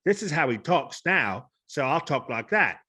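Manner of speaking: Cockney actor impression; mimicked British film star, punchy London vowels; Michael Caine. Shown as a demonstration. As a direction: slip into a put-on London accent. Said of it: "I'll be watching Michael Caine".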